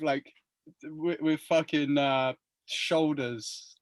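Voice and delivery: usual speaking voice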